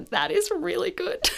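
high, laughing self-praise; proud of herself. Retold or imitated. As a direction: giggle through it, mock-proud. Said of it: "I was like".

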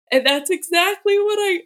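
words stretched by a yawn. Real, not acted. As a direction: talk through a yawn. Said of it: "I'm yawning".

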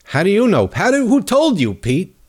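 sharp, high, demanding questions; indignant. Real, not acted. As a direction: fire the questions at him in disbelief. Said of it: "that pissed me off".

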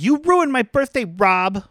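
put-on bratty girl whine. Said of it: "she's like".

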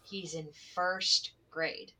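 slow, measured stress on "first grade"; "first grade" set apart deliberately; emphatic, important. Real, not acted. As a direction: spell out "first grade" slowly so it sticks. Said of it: "This is important".

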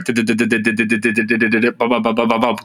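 rapid-fire vocal drum stutter; imitated drill beat. Shown as a demonstration. Podcaster putting Messi's dribbling in music terms, imitated drill beat. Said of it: "Messi's like drill music".

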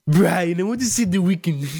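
fast, posh cartoon baby voice; Stewie Griffin impression. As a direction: play a scheming cartoon toddler talking to his dog. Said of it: "in a Stewie Griffin impression".

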